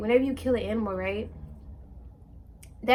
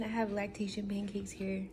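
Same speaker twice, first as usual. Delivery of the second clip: whispering; 10 dB quieter than usual. Real, not acted. Whispering while her baby sleeps.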